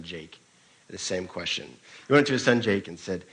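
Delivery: ordinary talk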